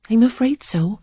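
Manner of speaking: lightly voiced sheepish lady; Sarah, apologetic. A narrator's reading.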